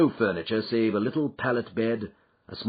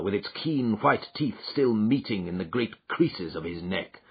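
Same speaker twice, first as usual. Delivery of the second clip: usual speaking voice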